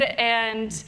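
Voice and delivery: normal talking voice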